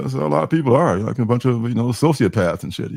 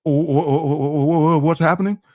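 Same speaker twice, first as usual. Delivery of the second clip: high, panicked stammering; scared stammer; 5 semitones higher than usual. Retold or imitated. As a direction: stutter in fright. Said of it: "it's just like".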